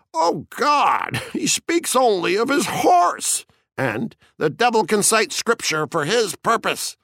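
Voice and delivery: high-pitched, jovial exclamation; good-humored cry. A narrator's reading. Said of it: "I cried in good humor".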